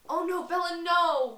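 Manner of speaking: high, loud anguished plea; dramatic, imitated desperation; agonized cry. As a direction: cry out in acted agony. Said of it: "called agony in his perfect voice".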